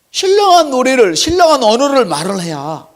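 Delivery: high, loud preaching cry; a pastor exhorting his flock, high and loud; fervent preaching. Acted. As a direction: lift the congregation with a cry. Preaching at full force.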